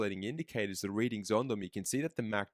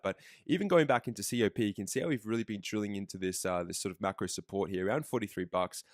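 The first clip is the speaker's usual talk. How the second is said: ordinary delivery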